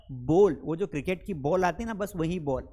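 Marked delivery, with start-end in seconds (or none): 0.1-0.6 बॉल said like "ball"; correct bowl. Shown as a demonstration. Teacher gives the right word for bowl: बॉल, like "ball". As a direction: say बॉल short and round, like the cricket ball. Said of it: "क्या पढ़ा जाता है?".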